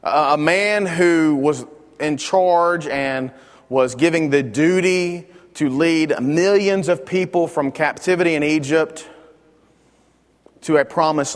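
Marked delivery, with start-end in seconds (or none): none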